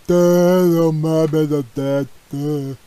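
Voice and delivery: a dopey mock voice, slow on "Mom, and Dad"; mocking a dimwit voice. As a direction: lightly play the idiot greeting his parents. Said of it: "It's like".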